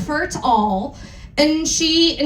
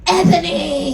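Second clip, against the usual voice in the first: mock yell; 5 semitones higher than usual. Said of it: "he yelled".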